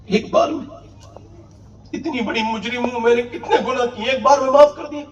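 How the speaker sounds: woman astonished she is forgiven; tearful disbelief. Retold or imitated. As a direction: gasp it through tears of relief. Weeping woman amazed at forgiveness.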